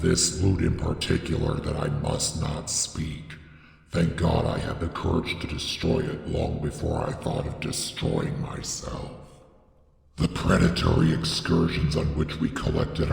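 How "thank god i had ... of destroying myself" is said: plain reading voice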